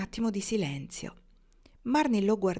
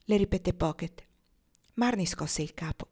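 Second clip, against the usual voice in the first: ordinary delivery, nothing marked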